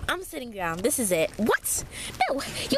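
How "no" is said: ordinary talk, nothing marked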